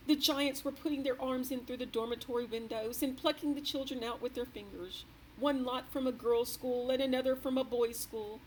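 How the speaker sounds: a grand lady still rattled by a nightmare; the queen's voice. A narrator's reading. The queen telling her maid a frightful dream, shaken.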